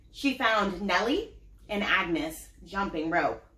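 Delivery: put-on lively storybook reading for kids; story narration. A narrator's reading. Mom reading a picture book to her child, animated. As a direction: read it out like bedtime to little ones.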